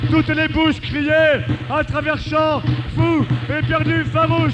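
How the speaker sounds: high, loud, driving recital; frenzied epic declamation. Acted. Reciter conjuring the giant spectre of rout, frenzied declamation. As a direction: hurl out each phrase at full force.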